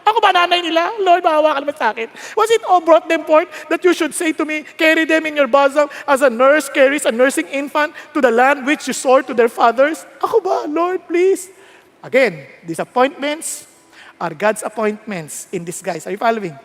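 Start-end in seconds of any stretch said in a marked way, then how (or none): 0.1-11.5 high, pressed complaint to God; worn-out prophet pleading upward; exasperated pleading. Acted. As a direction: protest loudly, begging for relief. Moses' complaint to God acted out.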